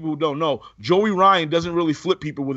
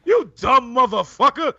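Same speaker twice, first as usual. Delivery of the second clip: high, hammed-up comic insult; raised-pitch mock outrage; Dave Chappelle; 6 semitones higher, 3 dB louder than usual. Shown as a demonstration. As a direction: throw it out like a sound clip. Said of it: "I gotta find Dave Chappelle's voice".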